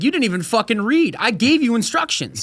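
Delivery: high-pitched mock outrage; exasperated scolding. Customer refusing a tip to the driver, exasperated. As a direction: scold in disbelief, voice pushed up.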